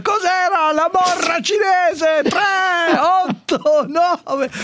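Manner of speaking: high, drawn-out mock shout; hammed-up high-pitched re-enactment; imitating the angry listener. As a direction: yell it out like an exasperated neighbour. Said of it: "A un certo punto fa".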